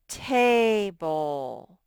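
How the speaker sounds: "Table" loud, with a long drawn-out AY; syllable modelling. Shown as a demonstration. Teacher sounding out vowels for first graders, loud syllable modelling. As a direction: stretch "Table" into TAAY-bul, projecting both vowels. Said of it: "The two vowels I hear are".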